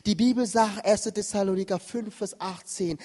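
ordinary delivery

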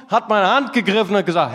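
ordinary talk

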